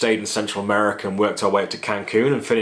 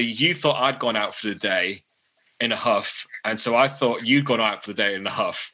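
ordinary talk

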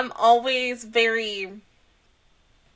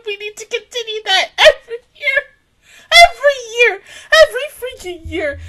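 higher, crying, insistent; tearful pleading; 9 semitones higher, 5 dB louder than usual. Real, not acted. Fan pleading for the group every year, tearful insistent cry. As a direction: beg through happy tears.

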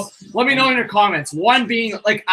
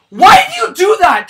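furious shout; raging gamer; 6 semitones higher, 8 dB louder than usual. Real, not acted. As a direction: yell it at the goalie. Fan raging at goalie.

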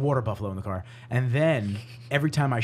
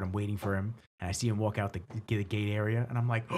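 normal talking voice

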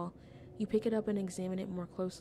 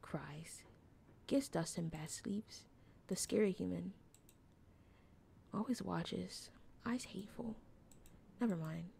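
hushed, slow opossum whisper; wary little opossum; 7 dB quieter, slower than usual. Acted. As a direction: whisper it in small, halting fragments. Voices an opossum character.

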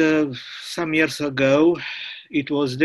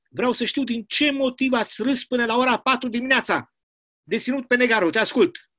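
angry commandant; 8 semitones higher than usual. Acted. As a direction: scold them like a furious prison chief. Commandant berates laughing prisoners.

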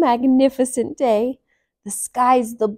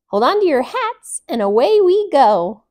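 higher, louder and thrilled; excited elephant; 5 semitones higher, 6 dB louder than usual. A narrator's reading. Emmy planning a party for her friends, high and thrilled.

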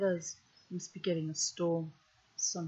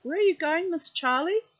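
much higher, louder sing-song call; 10 semitones higher, 7 dB louder than usual. Real, not acted. Reader calls after Charlie climbing away, playful loud call. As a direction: call across to her so she hears.

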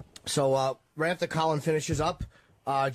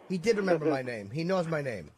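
usual speaking voice

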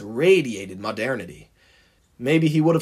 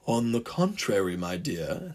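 soft, low, smooth contradiction; insisting; 4 semitones lower, 6 dB quieter than usual. A narrator's reading. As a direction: insist softly, sinking lower on the endearment. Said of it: "insisted the Diesel".

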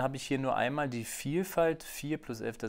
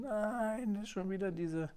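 dismayed; 9 semitones higher, 5 dB quieter than usual. Real, not acted. Sees his drawing go wrong.

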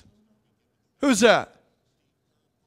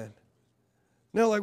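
ordinary delivery

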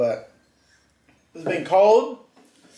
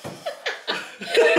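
breathy silent laugh; 12 semitones higher, 5 dB quieter than usual. Real, not acted. Laughing at partner's reaction.

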